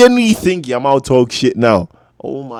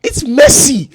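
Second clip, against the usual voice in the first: high, loud outburst of disbelief; excited shouting; 11 semitones higher, 7 dB louder than usual. Real, not acted. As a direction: yell it like you can't believe it. Erupts watching Messi's play.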